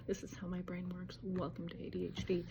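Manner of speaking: a confiding whisper to the camera; whispered aside. Real, not acted. Joking to viewers about her scattered brain, whispered. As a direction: whisper it, all breath. Self-deprecating ADHD aside.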